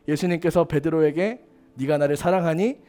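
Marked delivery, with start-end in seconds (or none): 1.7-2.8 softer and lower, gently asking again; quiet loving question; tender question. Acted. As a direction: coax the answer out tenderly. Jesus asks Peter again.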